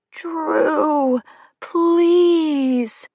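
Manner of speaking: high, far-off breathy plea in a put-on voice; mock begging lover. A narrator's reading. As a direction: beg "Drew, please" like a swooning girlfriend. Mock begging voice in retold story.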